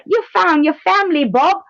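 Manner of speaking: high, put-on Australian accent. Shown as a demonstration. Repeating the demo for listeners, high mock Australian accent. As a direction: welcome them in a high, hammed-up Australian voice. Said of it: "My Australian".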